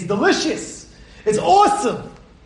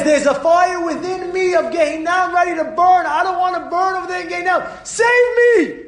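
desperate plea; 10 semitones higher than usual. Retold or imitated. Rabbi pleads, desperate.

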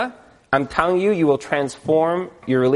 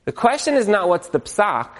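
normal talking voice

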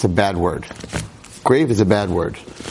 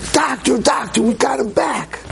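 high, breathless cry of good news; excited nurse cry; 13 semitones higher, slower than usual. Retold or imitated. Nurse bursting out to the doctor, high excited cry. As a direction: call out the miracle again, way up high. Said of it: "the nurse comes flying out".

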